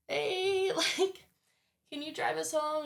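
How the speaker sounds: high sing-song request; high-pitched coaxing. Retold or imitated. Asking a sleeping guy for a ride, high-pitched coaxing.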